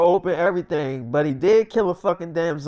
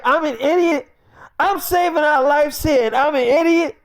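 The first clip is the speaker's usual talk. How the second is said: high, loud offended comeback; retold outraged reply to the wife; indignant retort; 9 semitones higher, 4 dB louder than usual. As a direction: throw the insult back at her. [name] indignant at being insulted.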